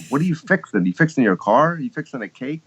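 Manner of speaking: mock bewilderment, voice lifted; baffled questioners. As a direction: imitate people baffled by the expression. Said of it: "And people".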